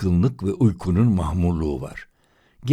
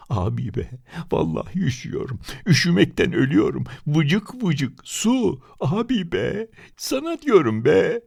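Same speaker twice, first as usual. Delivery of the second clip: put-on whining boy; 10 semitones higher than usual. Boy wails from cold.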